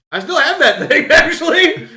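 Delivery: high, loud laughing confession. Real, not acted. Admitting to friends he kept it, laughing high and loud. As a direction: squeal it out through laughter.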